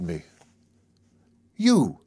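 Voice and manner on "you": high, startled exclamation; a sudden high burst of recognition; surprised cry. Acted. Maud recognising the narrator, crying out in surprise. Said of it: "she cried".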